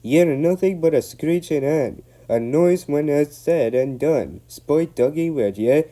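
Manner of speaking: loud, high Scottish telling-off; Scottish scolding. Acted. Donald scolding the van, angry Scottish. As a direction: scold the van in broad Scots. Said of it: "Donald burst out".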